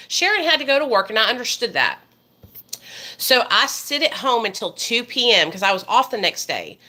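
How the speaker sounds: ordinary talk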